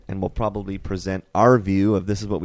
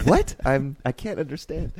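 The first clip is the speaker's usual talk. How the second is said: confused; 5 semitones higher than usual. Pretend. Confused listener hearing Hebrew.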